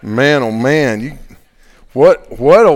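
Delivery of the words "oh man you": ordinary delivery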